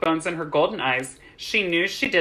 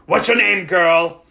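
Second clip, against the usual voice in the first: demanding; 3 semitones higher, 7 dB louder, slower than usual. A narrator's reading. Superintendent grips girl's arm, loudly demanding her name. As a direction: demand an answer from an underling. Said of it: "he demanded".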